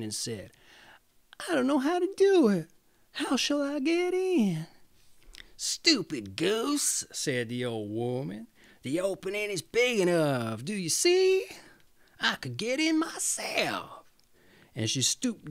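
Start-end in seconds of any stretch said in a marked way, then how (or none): 1.5-4.7 put-on high, fast, helpless child voice; innocent little girl. Gretel pretending not to understand the witch, high and fast. 5.6-14.0 high, scornful witch voice; mocking witch. Witch scolding Gretel at the oven, high and scornful.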